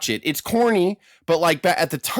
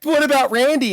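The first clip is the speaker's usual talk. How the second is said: much higher mock Liz voice; light girlish worried question; Elizabeth worried; 12 semitones higher, 4 dB louder than usual. Liz wakes and asks about Randy, mock worried. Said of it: "she's like".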